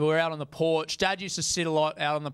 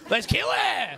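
mock mob cry; 13 semitones higher, slower than usual. Mock mob against the girl.

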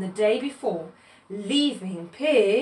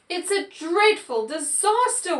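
high-pitched mock wail; 7 semitones higher than usual. A narrator's reading. Pig sees the oil left by Cow's mop, wailing. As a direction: wail it like a storybook victim. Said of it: "wailed Pig".

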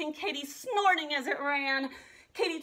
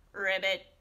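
frog croak; 8 semitones lower than usual. A narrator's reading. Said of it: "said a frog".

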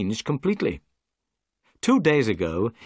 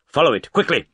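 louder, urgent orders; urgent shout; 4 semitones higher, 5 dB louder than usual. Acted. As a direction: push the crew to go faster. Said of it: "cried Holmes".

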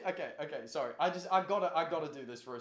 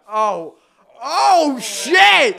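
loud, high real yelp of shock; shocked outburst; 10 semitones higher, 23 dB louder, much slower than usual. Real, not acted. Streamer stunned by his friends' move, real shocked yell. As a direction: caught totally off guard.

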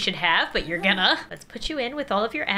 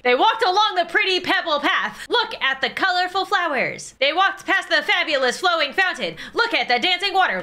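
high, hammed-up children's storyteller; better storybook voice; 6 semitones higher than usual. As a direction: read it with overblown singsong delight. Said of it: "I could do a better voice".

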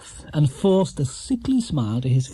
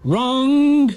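much higher, louder sharp "Wrong!"; loud exclamation; 11 semitones higher, 5 dB louder than usual. A narrator's reading. Quizmaster declaring the bear wrong, loud and high. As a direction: slam the verdict down. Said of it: "exclaimed Ronnie Playfair".

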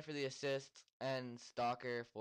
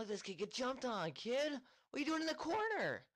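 yelling at the screen; 13 semitones higher than usual. Real, not acted.

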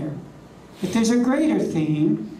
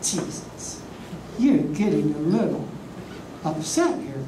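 mock scolding plea; 2 semitones higher than usual. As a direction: chide him like an exasperated friend. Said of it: "I said".

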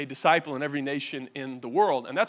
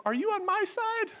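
Joshua asking. Retold or imitated. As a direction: voice jumps much higher, pleading question. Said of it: "what Joshua asked him".

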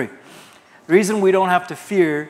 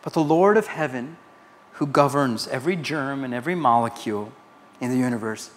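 ordinary talk, nothing marked

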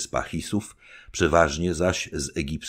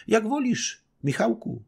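higher, demure reply; shy young wife. Acted. Young wife answering her husband, a hint of shyness. Baśka voiced by reader.

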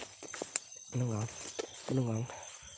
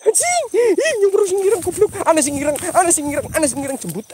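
shrieking high and loud, gradually coming down; startled panic; 12 semitones higher, 7 dB louder than usual. Real, not acted. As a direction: scream in fright, repeating yourself frantically. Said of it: "Kaget nyung kok". (menjerit kaget, panik)

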